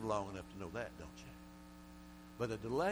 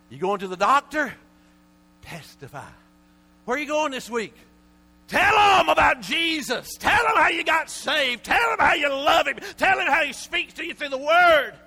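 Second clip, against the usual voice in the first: preacher crying out from the pulpit; building to a shouted preach; 21 dB louder than usual. Real, not acted. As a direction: rise up and shout it to the church.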